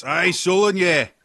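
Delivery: loud, high, slow hearty sea-dog; rough pirate captain. Acted. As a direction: boom out like a jovial old pirate. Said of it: "another voice".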